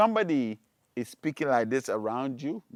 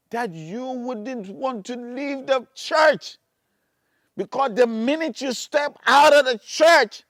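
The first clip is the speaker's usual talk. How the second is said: loud, high, hammering sermon; thundering warning from the pulpit; full-force preaching; 11 semitones higher, 13 dB louder, slower than usual. Acted. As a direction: preach it at a shout, stretching key words. Preaches at full force about curses.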